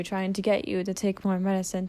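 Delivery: usual speaking voice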